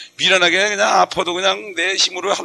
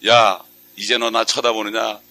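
God's voice; 6 semitones lower, slower than usual. Retold or imitated. Voicing God in sermon.

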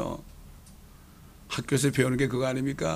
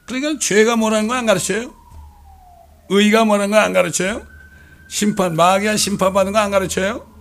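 loud rising questions, preaching hard; fiery sermon questions; forceful preaching; 5 semitones higher, 7 dB louder than usual. Acted. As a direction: hammer each question at the congregation.

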